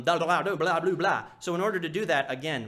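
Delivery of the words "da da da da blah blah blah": sloppy blurred blah blah; mock the blended version; mushy blah. Shown as a demonstration. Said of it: "and not".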